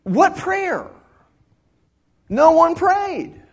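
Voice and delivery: a reader who cannot believe the line; hammed-up disbelief at the passage; incredulous. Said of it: "I read that going".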